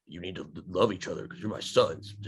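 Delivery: low, muttered fatherly scolding; John Witherspoon. Retold or imitated. Father to his fighting sons, low mild scolding. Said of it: "what John Witherspoon was just like".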